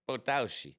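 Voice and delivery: lightly put-on Italian accent; playful touch of Italian. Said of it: "said it like I was Italian".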